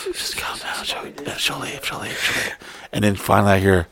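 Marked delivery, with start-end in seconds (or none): 0.1-2.5 barely audible whisper; whispered self-calming. Retold or imitated. Man hiding behind the bar, whispering to calm himself. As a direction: whisper a repeated reassurance to yourself. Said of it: "I'm doing breathing exercises".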